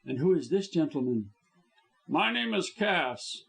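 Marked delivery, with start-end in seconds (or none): 2.1-3.4 enraged. A narrator's reading. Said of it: "enraged at the man's impudence".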